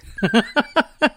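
real giggle, rising; quick bright giggle; short high laugh. Real, not acted. To listeners after calling herself a happy dog, giggling.

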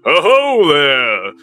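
loud booming slow paladin greeting; magically amplified voice. Acted. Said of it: "this loud, booming voice fills your ears".

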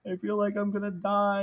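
high anxious voice. Retold or imitated. Retold plea during panic.

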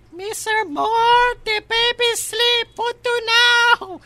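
very high, loud, slow caricature; hammed-up high yelling servant voice; shouting housekeeper. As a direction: screech it slowly to the boss. Plays housekeeper calling employer.

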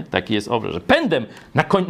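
ordinary talk, nothing marked

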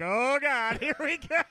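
high-pitched, laughing mock panic; mock dread. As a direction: play panicked bluffing through a laugh. Acted dread at being found out.

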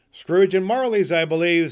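loud, high, hearty greeting; hearty gentleman. Acted. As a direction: boom a polite hello.

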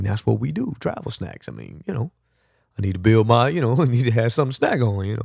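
normal talking voice

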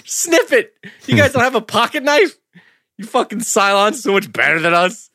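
high, laughing exasperation at the screen; hammed-up high scolding; mock exasperated yelling. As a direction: scold the characters through a laugh.

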